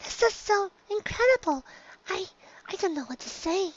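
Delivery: high, soft, awed wonder; shy pony thrilled by a dragon; soft glee. A narrator's reading. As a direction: breathe out your delight. Fluttershy in glee.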